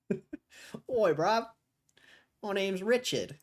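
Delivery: high-pitched put-on British accent; mock English lad, pitched well up; Richard. Introducing himself as British alter ego, hammed-up accent. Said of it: "I spoke to Richard on the phone".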